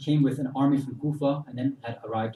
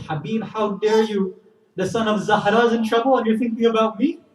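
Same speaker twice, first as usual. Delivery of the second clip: higher-pitched, mildly outraged challenge; indignant rebuke; 8 semitones higher, 5 dB louder than usual. Acted. Wife rebukes husband for hesitating to go, indignant rebuke. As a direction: scold him in raised disbelief.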